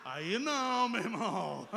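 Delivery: drawn-out groan rising into loud objection; outraged protest. Retold or imitated. Resident objecting to a slur on his neighbourhood, mock outraged protest. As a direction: groan long, then burst out protesting.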